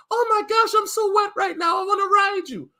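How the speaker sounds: high, breathless mock woman voice. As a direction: mimic a girlfriend suddenly aroused. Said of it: "she's like".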